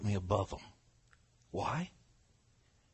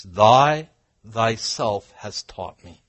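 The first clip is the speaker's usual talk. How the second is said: THY THYSELF hit hard and loud, drawn out; loud, weighty emphasis on "Thy thyself"; emphatic stress; 17 dB louder, much slower than usual. Shown as a demonstration. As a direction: slowly punch out "Thy thyself". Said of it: "look, he emphasizes".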